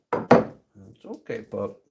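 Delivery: slow, gentle reassurance to a nervous pup; calm the scared dog down, unhurried; soothing the dog. Real, not acted. Soothing his nervous dog during the hammering, slowly and gently.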